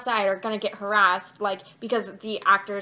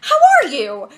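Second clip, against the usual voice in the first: much higher, loud excited shout; excited greeting; 6 semitones higher, 11 dB louder, slower than usual. Retold or imitated. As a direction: retell his thrilled outburst at Kristen. Actor greets friend mid-show.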